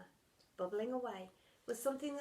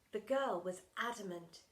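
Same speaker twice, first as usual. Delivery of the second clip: usual speaking voice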